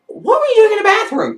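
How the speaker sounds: high-pitched acted bafflement; incredulous question. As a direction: ask it in a high, disbelieving voice. His baffled question to her.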